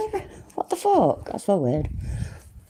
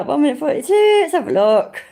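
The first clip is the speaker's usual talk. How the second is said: usual speaking voice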